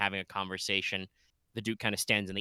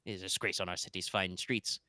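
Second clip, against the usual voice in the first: usual speaking voice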